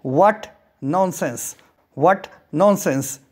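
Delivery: very slow, clear teacher reading; slow model phrase. Shown as a demonstration. Teacher modelling the phrase slowly for students. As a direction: stretch it out word by word. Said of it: "इसे English में कहेंगे".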